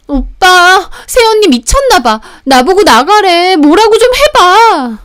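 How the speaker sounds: very high, loud drawn-out whine; whining appeal. Acted. Thrown-out sister demanding her brother step in, whining. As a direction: plead with big brother in shrill outrage.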